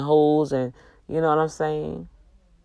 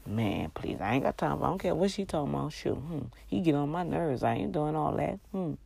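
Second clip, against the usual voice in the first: dismissive muttering; 9 dB quieter than usual. Pretend. Wife dismissing husband on phone.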